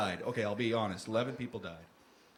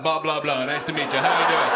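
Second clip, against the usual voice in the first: hearty greeting; 2 semitones lower, 8 dB louder than usual. Acted. Playing Bob Loblaw greeting.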